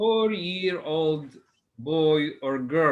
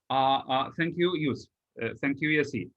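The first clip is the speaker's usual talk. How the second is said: ordinary talk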